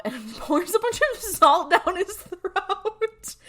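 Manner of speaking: high, loud laugh through the words, slow; cracking up mid-sentence; laughing out the end of the story. Real, not acted. Laughing while recounting the scene.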